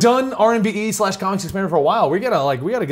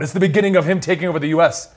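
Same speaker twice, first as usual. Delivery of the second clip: ordinary talk, nothing marked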